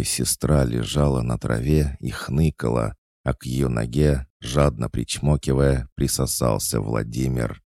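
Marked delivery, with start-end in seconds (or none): none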